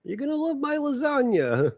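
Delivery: high-pitched Italian restaurateur caricature; mock Italian accent. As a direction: pitch the dish like a hammed-up Italian owner. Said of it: "like he owns a restaurant in fucking Little Italy".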